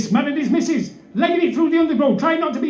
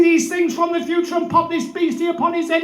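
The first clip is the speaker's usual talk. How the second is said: usual speaking voice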